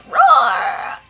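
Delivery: loud high mock roar; dinosaur roar. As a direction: roar like a stomping velociraptor. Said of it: "walk around the room like a dinosaur".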